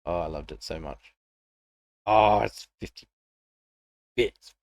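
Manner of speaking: normal talking voice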